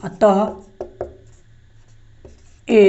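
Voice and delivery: each word set apart by long pauses; slow dictation. Shown as a demonstration. Teacher dictating to students, long pauses between words. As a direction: dictate it slowly so students can write.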